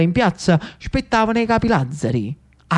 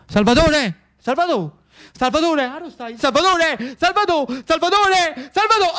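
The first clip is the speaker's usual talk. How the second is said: calls climbing higher and higher; anxious calling; 10 semitones higher than usual. Acted. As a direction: call out for your brother, more worried each time. Searching the house for brother. (Lucio chiama il fratello, sempre più in ansia e più acuto)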